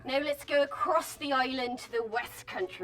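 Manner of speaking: a touch of rounded West Country. Shown as a demonstration. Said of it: "a much rounder sound".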